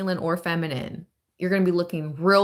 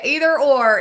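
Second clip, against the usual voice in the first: higher and louder, still thrilled; excited; 6 semitones higher, 7 dB louder than usual. Real, not acted. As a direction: keep the excitement up. Repeats the phrase excitedly.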